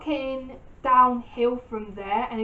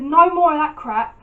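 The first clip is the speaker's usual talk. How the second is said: put-on louder, fed-up snap at the lying boyfriend; angry retold; 3 semitones higher, 7 dB louder than usual. Furious girlfriend demanding the truth from her cheating boyfriend, loud. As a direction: let the anger out in a short burst. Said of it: "my blood was boiling".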